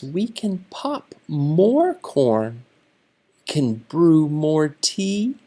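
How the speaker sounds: a hint of a cheerful mouse; lightly voiced mouse. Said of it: "Mouse says".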